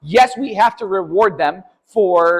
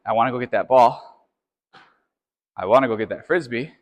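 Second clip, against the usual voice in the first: lower, musing inner voice of the dog; voicing the dog's thoughts; 8 semitones lower than usual. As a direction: speak the dog's temptation to himself, low. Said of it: "A dog that is processing his decision".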